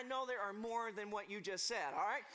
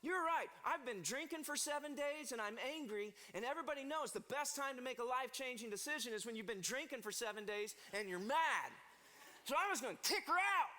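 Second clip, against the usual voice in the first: put-on angry drunk king; 3 semitones higher than usual. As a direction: blurt it out, fed up and tipsy. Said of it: "Xerxes says".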